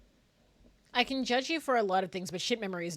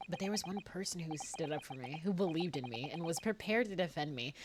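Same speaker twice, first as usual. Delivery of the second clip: low, soft recollection; solemn game character; 3 semitones lower, 8 dB quieter than usual. Acted. Character recalling a loyal defender, low solemn voice. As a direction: tell it with quiet gravity.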